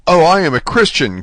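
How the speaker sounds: a loud, high cry of pleading; cried out. A narrator's reading. Prisoner cries out to the unseen neighbour, loud and high. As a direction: cry it out, afraid of being left. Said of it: "cried Dante".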